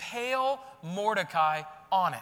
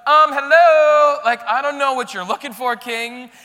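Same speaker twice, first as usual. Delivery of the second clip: sassy servant; 6 semitones higher, 17 dB louder than usual. Retold or imitated. Eunuch answering the panicking king, mock sassy. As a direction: play the servant pointing out the obvious. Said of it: "The guy's like".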